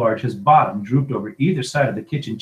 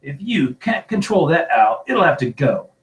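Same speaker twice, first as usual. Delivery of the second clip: raised voice, laying down the law; roaring; 5 semitones higher, 5 dB louder than usual. A narrator's reading. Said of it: "he roared across the table".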